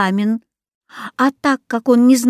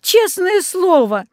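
high, slow begging plea; pleading; 9 semitones higher, slower than usual. A narrator's reading. Boy begs his father to believe him, pleading. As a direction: beg him desperately to believe you. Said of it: "взмолился я".

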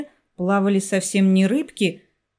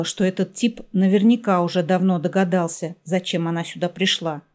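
ordinary delivery

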